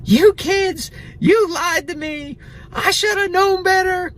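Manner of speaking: hammed-up high-pitched mother scolding her kids; mock mom voice. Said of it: "And my mom goes".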